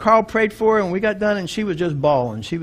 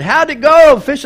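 higher and louder, a cheerful call; cheery lady greeting; 7 semitones higher, 10 dB louder, slower than usual. Retold or imitated. Dock lady greeting the returning fishermen, cheerful call. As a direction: call out a friendly how'd it go.

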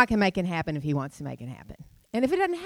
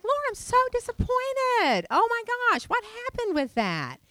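much higher, hammed-up retold lament; pitched-up mock dismay at a cancelled snorkel day; mock disappointed wail. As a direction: cry out to the Lord in mock despair. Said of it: "I was like".

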